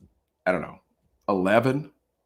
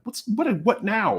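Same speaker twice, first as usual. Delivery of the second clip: high, drawn-out questioning; acted-out bewildered dismay; retold exasperation; 11 semitones higher than usual. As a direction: stammer it high and slow, fed up. Hears another roar of laughter.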